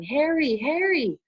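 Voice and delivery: high, loud calling out; crying out. A narrator's reading. Distant voices calling Harry's name, high and loud. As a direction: call out his name, high. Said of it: "other voices ... crying".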